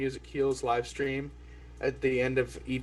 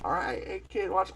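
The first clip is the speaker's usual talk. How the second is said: higher-pitched playful grandpa voice; grandpa showing a trick; 9 semitones higher than usual. Acted. Grandpa shows a kid the thumb trick, higher-pitched put-on voice. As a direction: play a grandpa setting up a trick. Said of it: "the grandpa goes like".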